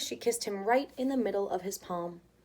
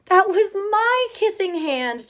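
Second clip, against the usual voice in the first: slow, high, louder heartbroken cry; saddest voice; 10 semitones higher, 10 dB louder, slower than usual. Acted. Chester sees his brother kissed, slow high heartbroken voice. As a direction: gasp it out heartbroken, slowly. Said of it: "gasped in the saddest voice".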